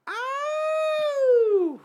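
sharp high yelp of pain. Retold or imitated. Girlfriend yelping from the bathroom, high pained yelp. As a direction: yelp like it suddenly stings.

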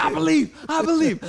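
imitating an excited convert, high and loud; hyped believer. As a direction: echo the rallying cry with full faith. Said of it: "he's getting hyped".

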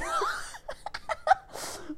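a high, breathy burst of laughter; high squealing laugh. Real, not acted. Laughing at her bleeding friend's threat, a high squeal.

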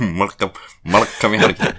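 amused, laughing repeat. Real, not acted. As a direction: repeat it back through real laughter.

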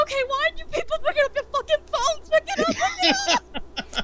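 very high, fast squealed demand; frantic fangirl. Retold or imitated. Fan calling everybody she knows, frantic. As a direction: squeal desperately into the phone, retold. Retold frantic phone calls after meeting.

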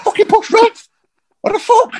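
very high-pitched outraged falsetto, half laughing; dead high voice. Retold or imitated. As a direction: re-enact the shock in a squeaky high voice. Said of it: "every voice was dead high".

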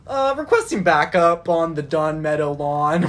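loud, slow radio call for backup; police radio. Pretend. Pretend walkie-talkie call.

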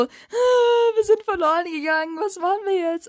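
put-on high, crying child panic; panicked little brother. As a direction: whine in panic like a lost kid. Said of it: "mein Bruder so". (hoher, weinerlicher kleiner Bruder)

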